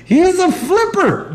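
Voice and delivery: high, mocking sing-song. Real, not acted. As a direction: tease him in a high voice.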